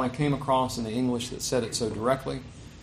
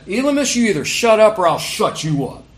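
loud, raised-pitch warning; acted threat; 7 semitones higher, 8 dB louder than usual. Preacher voices Paul confronting Elymas, acted loud threat. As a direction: shut him up with a forceful, imagined threat.

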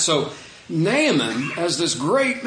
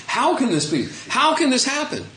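incredulous questioning; 9 semitones higher than usual. Retold or imitated. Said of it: "It's like Nicodemus in my mind".

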